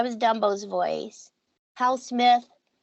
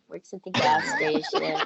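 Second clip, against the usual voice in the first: usual speaking voice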